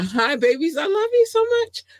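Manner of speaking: high delighted squeal; gushing love. Real, not acted. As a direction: coo it at the babies. Gushing to listener babies.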